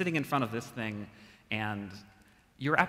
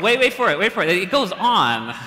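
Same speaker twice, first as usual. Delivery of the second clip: excited; 8 semitones higher, 13 dB louder than usual. Real, not acted. Excited aside mid ad reading.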